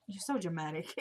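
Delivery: low, soft parting jab; retold low-key dig; dismissive aside.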